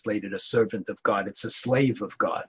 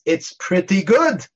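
louder, slow drawn-out approval with a laugh; dramatic grudging praise; 5 semitones higher, 6 dB louder, slower than usual. Retold or imitated. Rabbi finally compliments his trembling assistant, slow loud praise. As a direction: let the praise land slowly after the scare. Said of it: "and then he says".

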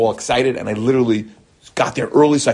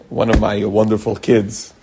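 ordinary delivery

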